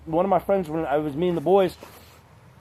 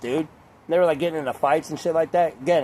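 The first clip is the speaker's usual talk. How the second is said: ordinary talk, nothing marked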